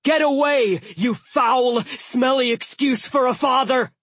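high, loud furious shout; booming yell. A narrator's reading. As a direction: roar it at the dragon. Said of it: "The voice boomed again".

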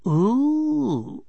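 high, long cooed ooh; admiring coo. A narrator's reading. Flirting woman squeezing his bicep, admiring coo.

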